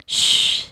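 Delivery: soothing unvoiced shush, quiet; soft shush. Brother hushing his weeping sister, soft soothing shush. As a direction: hush her gently like calming a child.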